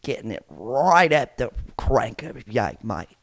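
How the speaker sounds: drawn-out, hammed-up Aussie accent; bad Australian accent. Host mocking how Australia is getting it, bad Australian accent. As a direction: put on a poor Australian accent, stretching it out. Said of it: "I don't think that was a good accent".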